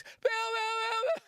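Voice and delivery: high-pitched yelling little fan at the fence; high shouting kid fan. Retold or imitated. Kid fan shouting at the fence as Bill drives out, high yell. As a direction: scream his name through the fence. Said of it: "and he's".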